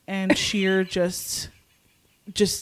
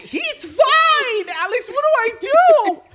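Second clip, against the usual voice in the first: high, loud, stretched-out wail; panicked yelling; 7 dB louder, slower than usual. Retold or imitated. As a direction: shriek helplessly at a friend. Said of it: "in panic".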